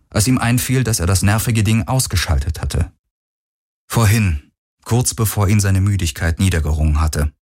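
ordinary delivery

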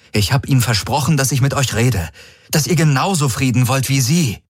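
lightly voiced desperate plea; pleading envoy. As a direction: beg her to call the ships back. Garlin pleads again.

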